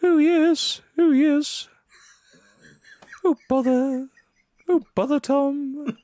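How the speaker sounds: hammed-up cartoon bear; Winnie the Pooh voice. Podcast host teasing his co-host, hammed-up high Pooh voice. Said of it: "Australian Winnie the Pooh saying".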